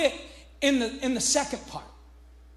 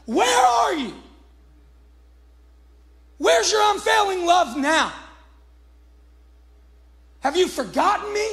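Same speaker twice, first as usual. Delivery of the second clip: a preacher voicing the psalmist's anguished cry; desperate cry; 4 semitones higher than usual. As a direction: shout it up at heaven, abandoned. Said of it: "he's like".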